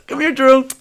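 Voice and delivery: high, loud calling coo; mock dog-owner call. Owner calling a dog named Drew, high mock coo. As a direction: coo the name like a doting owner.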